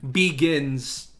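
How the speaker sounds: ordinary delivery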